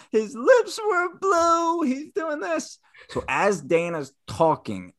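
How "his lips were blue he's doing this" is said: very high, drawn-out mock wail; frazzled. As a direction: wail it slowly, imitating a distraught friend. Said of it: "sounded frazzled".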